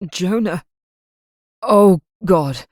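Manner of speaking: loud, raw cry of horror; anguished cry. Acted. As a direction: burst out in despair. Father realises horror, vomits.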